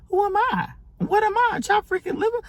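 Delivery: a hurt woman's thoughts shrieking in disbelief; high squeaky disbelief. Retold or imitated. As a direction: squeak it out like you can't believe it.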